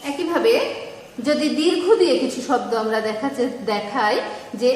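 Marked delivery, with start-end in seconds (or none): none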